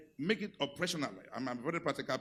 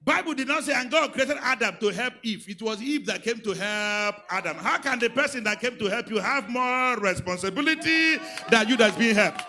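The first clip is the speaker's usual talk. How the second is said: loud, higher, rising to a shout; preaching at full force; 7 semitones higher, 11 dB louder than usual. Real, not acted. Pastor challenging husbands, shouted preaching. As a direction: thunder it at the men.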